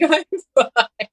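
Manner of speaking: high, laughing brush-off; a giggly high "I'm fine". Retold or imitated. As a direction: laugh through it. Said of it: "start sobbing and be like".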